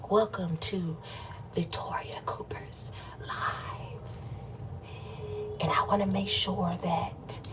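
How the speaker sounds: slow soft whisper, barely voiced; ASMR whisper. Shown as a demonstration. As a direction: whisper into the mic like an ASMR host. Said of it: "if y'all want me to do A S M R".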